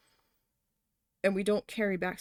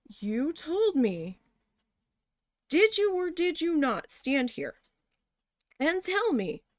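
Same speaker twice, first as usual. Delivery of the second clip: halting, pitch-raised confrontation; exasperated breakdown; 4 semitones higher than usual. Retold or imitated. Retelling a near breakdown at the boss, exasperated and emphatic. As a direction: pause hard between phrases, demanding the boss admit it. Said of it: "I almost had a nervous breakdown".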